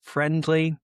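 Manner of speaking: ordinary talk, nothing marked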